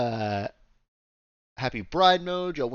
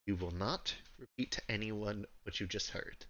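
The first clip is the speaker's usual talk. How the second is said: soft, measured in-character warning; quiet warning; 2 semitones lower, 10 dB quieter than usual. Acted. She turns to Serenity, quiet warning.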